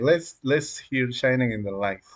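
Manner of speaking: ordinary talk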